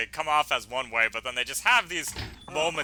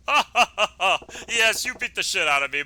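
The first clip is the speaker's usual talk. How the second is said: hearty acted laugh; 5 semitones higher than usual. Patriarch laughs off his beating.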